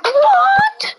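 very high, drawn-out disbelief; astonished squeal. Real, not acted. Hearing about the trident's loyalty, astonished squeal. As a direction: squeal it in shock, pitch way up.

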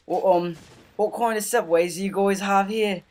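high, loud put-on British accent; put-on British customer voice. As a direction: greet them as a hammed-up Brit. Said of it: "I put on my British accent".

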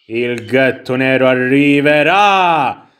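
slow, drawn-out mock-ominous proclamation; dramatic announcement. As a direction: stretch it out like a grand prophecy. Annuncio teatrale del gatto nero.

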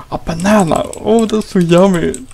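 thrilled to find food; delighted gasp. Real, not acted. Finds a banana, delighted.

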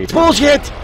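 mock indignant outburst on bullshit; high outraged shout. Shown as a demonstration. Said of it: "It's bullshit, I say".

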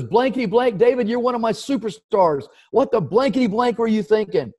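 mock angry boss, pitched high; retold cursing. Older executive scolding a star manager, mock angry. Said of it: "he cursed at me".

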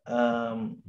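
ordinary talk, nothing marked

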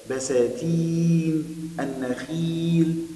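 بساتين and النخيل with the long ي held very long, slow and sung; stretched long vowel. Shown as a demonstration. Teacher showing pupils the long ي vowel, drawn out and sung. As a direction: draw out the ي in بساتين and النخيل like a chant. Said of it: "كل ده فيه مد بالياء".

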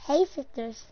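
lightly imitated vlogger greeting; James Charles. Greeting the sisters, light mock vlogger voice. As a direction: give a light mock influencer hello. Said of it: "no, J-, James Charles".

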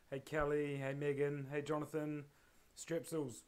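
ordinary talk, nothing marked

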